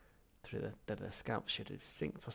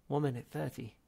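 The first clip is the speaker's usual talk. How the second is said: plain reading voice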